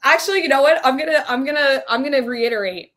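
loud, high excited outburst. Real, not acted. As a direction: burst in with excitement. Excited by new joke.

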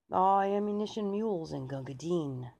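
loud soldier's cry; front-rank shout. Acted. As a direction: call it out like a soldier under fire. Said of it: "You could hear the front rank shout".

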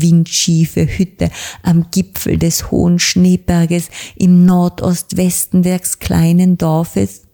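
ordinary delivery

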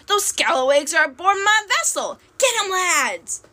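high-pitched yelling pirate captain; rally the crew in outrage, stretching the final order; yelled. A narrator's reading. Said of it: "Blackbeard yelled somewhere behind us".

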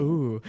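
low, soft swooning ooh; mock swooning ooh. Mocking Markiplier's crush, a low swooning ooh. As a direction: coo like a smitten fan. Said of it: "he just be like".

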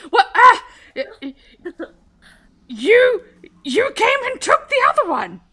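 flustered goblin blurting it out; nervous guard, caught off guard; rattled guard. Acted. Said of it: "looks a bit rattled. He says".

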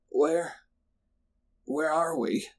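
soft, halting, dazed question; groggy groan. A narrator's reading. Narrator sitting up slowly after a blow, groaning. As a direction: groan it weakly, just coming round.

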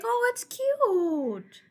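high, slow cooing "Oh, it's cute". Retold or imitated. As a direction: coo it like something adorable. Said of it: "It's like".

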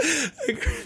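real helpless laughing fit mid-sentence; laughing too hard to finish; breaking into laughter. Real, not acted. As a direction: crack up until the words fail. Cracks up telling own story.

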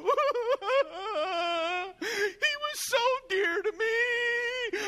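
hammed-up high, slow wailing sob; mock wailing mourners. Veiled girls at the fake funeral, hammed-up wailing.

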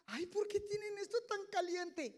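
high, whiny mock grumble; mock complaint. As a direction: whine about the heat like a fussy churchgoer. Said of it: "la inconformidad de la gente". (queja burlona y aguda de feligrés)

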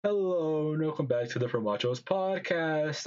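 normal talking voice

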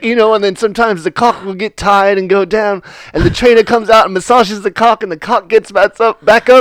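put-on British voice, lilting upward at the end; imitating a British man. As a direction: innocently explain it as the British guy. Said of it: "And he's like".

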